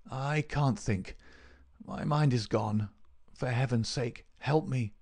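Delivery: slow, weak pleading of a sick man; feigning deathly illness. Acted. Sick Holmes pleads with Smith.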